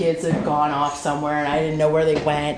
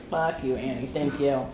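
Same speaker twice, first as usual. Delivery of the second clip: soft, lower mock curse turning into thanks; grudging thanks; 4 semitones lower, 8 dB quieter than usual. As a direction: mutter a fond curse, then thank her. Grudging thanks to her friend.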